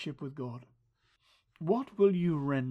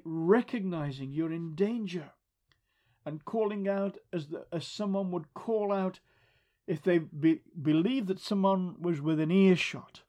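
normal talking voice

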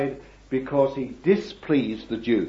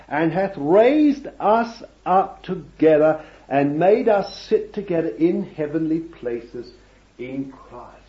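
building to louder, higher proclamation; scripture reading; 4 semitones higher, 5 dB louder than usual. Real, not acted. Preacher reading Ephesians to the church, swelling and louder. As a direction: rise in wonder as you read. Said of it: "and it says".

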